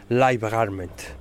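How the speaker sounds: ordinary talk, nothing marked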